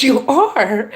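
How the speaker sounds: high surprised query; surprised question. Retold or imitated. Woman questions young man's friendship search, surprised. Acts out her surprise at his answer.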